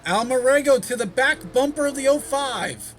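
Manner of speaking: high, loud play-by-play; excited race call. Real, not acted. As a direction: call the move as it happens. Close battle for the lead.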